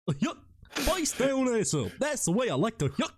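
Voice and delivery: high, hiccupping cartoon dog impression; Goofy. Host teasing co-host, goofy cartoon voice. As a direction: chatter like a goofy cartoon character. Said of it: "a conversation between Goofy and fucking Mickey".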